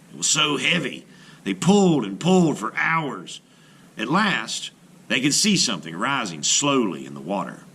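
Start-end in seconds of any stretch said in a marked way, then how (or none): none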